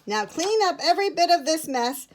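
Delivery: high, emphatic command, slowing at the end; sharp order. A narrator's reading. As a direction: order the children about sharply. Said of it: "Mister Bear ordered sharply".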